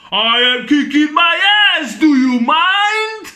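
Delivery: loud, high, hammed-up yell; manic indignant shout. Retelling Fletcher caught beating himself up, manic mock shout. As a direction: shout it, stretching the last word right up high.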